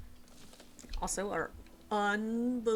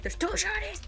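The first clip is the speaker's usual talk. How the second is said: breathless, thrilled whisper over shinies; excited whisper; 10 semitones higher, 4 dB louder than usual. Real, not acted. Streamer spotting two shinies, thrilled whispering. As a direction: hiss the find out in a rush.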